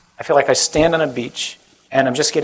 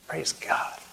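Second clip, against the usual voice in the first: breathy whispered praise; whispered aside; 13 dB quieter than usual. Real, not acted. Whispering to the crowd in passing. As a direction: drop to a whisper for a quick aside.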